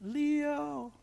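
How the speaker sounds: high, soft, long-held call; faint distant voice. Retold or imitated. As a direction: call softly as if from far away, stretching it. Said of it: "heard a voice way up going".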